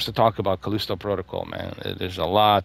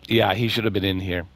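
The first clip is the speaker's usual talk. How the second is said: ordinary delivery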